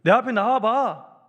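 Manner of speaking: loud, slow summons; one-sided command. Acted. God calls sulking Elijah out of the cave, loud command. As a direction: call him out forcefully, word by word. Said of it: "오히려 일방적으로".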